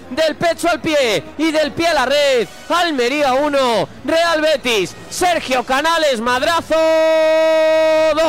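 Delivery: high, loud goal commentary; excited play-by-play. Real, not acted. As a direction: keep riding the goal excitement. Goal call continues.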